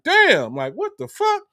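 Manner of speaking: imitating a stunned guy; mock shock. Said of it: "So you like".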